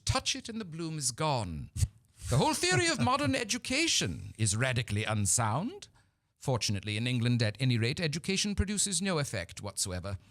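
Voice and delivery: high, haughty British matriarch lecturing; stately Victorian dowager holding forth; Lady Bracknell. Acted. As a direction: pronounce with grand disdain. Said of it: "says Lady Bracknell".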